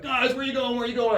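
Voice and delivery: high-pitched mock dismay; calling after teammates. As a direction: call plaintively after friends who are leaving. Acted calling after departing teammates.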